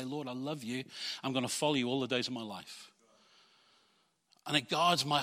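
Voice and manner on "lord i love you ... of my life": soft prayer. Acted. Voicing prayer to God.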